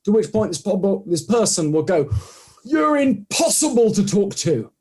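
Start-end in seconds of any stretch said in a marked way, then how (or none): 2.6-4.7 high, slow, drawn-out mock exasperation; raised retold huff; exasperated dismissal. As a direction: imitate someone fed up and leaving. Retold person dismisses him, walks off.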